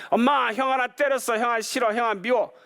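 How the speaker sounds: put-on high whiny child voice; tattling little kid. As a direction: whine like a younger brother tattling. Little brother tattling again.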